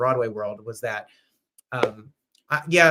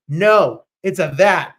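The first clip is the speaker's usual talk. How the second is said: emphatic correction; 2 semitones higher, 9 dB louder, slower than usual. Real, not acted.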